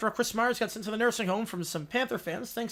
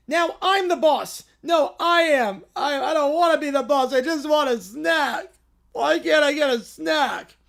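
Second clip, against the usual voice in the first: squabbling cartoon kids; 3 semitones higher, 3 dB louder than usual. Kids left alone arguing over being boss. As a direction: argue and whine like toddlers.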